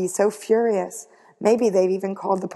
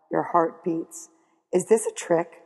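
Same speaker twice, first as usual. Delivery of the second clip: ordinary delivery, nothing marked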